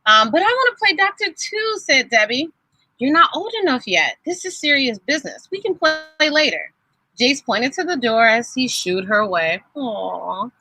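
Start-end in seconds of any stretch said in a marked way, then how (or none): 0.3-1.8 much higher pleading whine; little girl begging to play; whiny little sister. A narrator's reading. Debbie begs to join. 3.0-6.7 higher, patronizing refusal; a hint of a lofty older brother; dismissive big brother. A narrator's reading.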